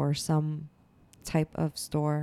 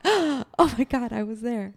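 shocked; 7 semitones higher, 3 dB louder than usual. Retold or imitated. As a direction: act the boyfriend caught off guard. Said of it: "he was like".

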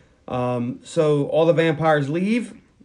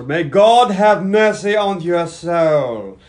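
slow, loud, grave pronouncement; slow, heavy movie villain-hunter line; solemn professor; 3 semitones higher, 7 dB louder, much slower than usual. Retold or imitated. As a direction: deliver it like a final sentence.

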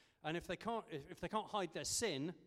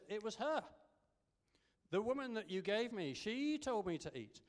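higher-pitched imitation of passing the blame; mock blaming; 6 semitones higher than usual. Preacher voices Adam blaming the woman, mock accusing. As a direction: act out pinning it on someone else. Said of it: "began the blame game".

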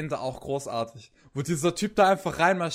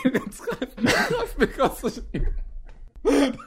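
laughing; 9 semitones higher than usual. Real, not acted. Repeating joke through laughter.

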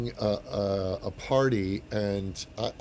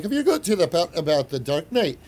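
put-on lightly done Scottish accent, raised pitch; a hint of a Scottish accent; 7 semitones higher, 5 dB louder than usual. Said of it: "forgive my poor Scottish accent".

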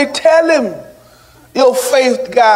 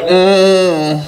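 long, low closed-mouth "mm"; mock grunting hum; 4 semitones lower than usual. People passing someone who hurt them, mock low hum. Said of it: "You be like".

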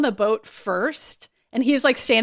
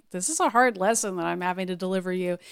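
subdued, softer and lower retelling; sad; 4 semitones lower, 5 dB quieter than usual. Retold or imitated. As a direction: imitate gentle regret, voice dropped. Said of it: "he seems sad too".

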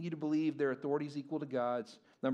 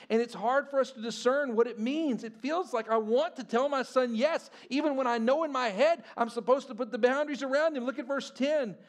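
preaching; 7 semitones higher, 7 dB louder than usual. Acted.

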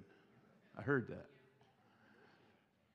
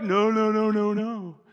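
mock refusal; 5 semitones higher, 10 dB louder than usual. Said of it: "And they're like".

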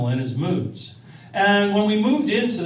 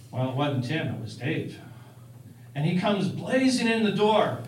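ordinary talk, nothing marked

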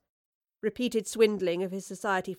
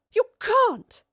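very high, loud cry of protest; 9 dB louder than usual. A narrator's reading. Woodliffe dragged inside by two thugs, crying out. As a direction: cry out as you are dragged off. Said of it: "cried Woodliffe".

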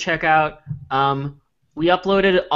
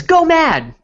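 excited exclamation; 12 semitones higher, 6 dB louder than usual. Acted. Reading the button aloud.

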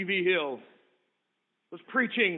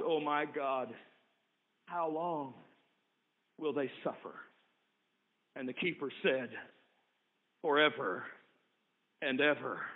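very low and soft, long pauses; near-whisper of dread; hushed despair; 7 semitones lower, 9 dB quieter than usual. Acted. Sister and keeper speaking of eternal suffering, low and soft. Sobbing question, keeper's answer.

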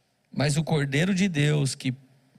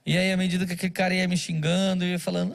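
normal talking voice

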